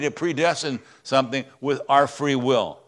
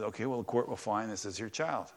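softened, moved; 4 semitones lower, 10 dB quieter than usual. Real, not acted. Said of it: "I get emotional every time".

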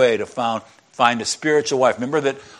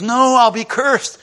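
acted protest; 8 semitones higher than usual. Son protests mother's scheme.

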